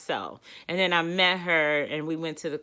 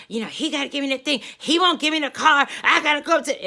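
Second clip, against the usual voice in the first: friend's excuses; 7 semitones higher, 4 dB louder, much faster than usual. Retold or imitated. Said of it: "And she's like".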